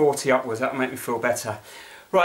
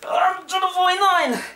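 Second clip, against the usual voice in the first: high, slow, strained cries; straining effort; 12 semitones higher, much slower than usual. Real, not acted. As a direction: force the last counts out. Final sit-ups, near crying.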